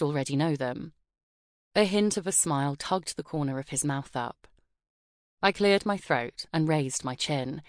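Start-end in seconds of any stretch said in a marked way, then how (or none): none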